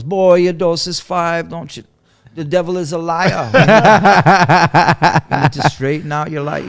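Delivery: high, loud imitated Jamaican scolding; Jamaican nurse. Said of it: "my nurse was this Jamaican lady".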